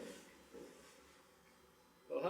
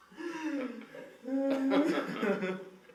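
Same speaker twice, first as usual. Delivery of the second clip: high laughing burst; big laugh; 13 semitones higher, 5 dB louder than usual. Real, not acted. After the dummy joke.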